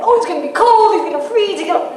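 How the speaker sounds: high, anxious mock fretting; worried parent fretting. A parent fretting that the son will freeze. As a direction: fret over the cold like a nervous parent.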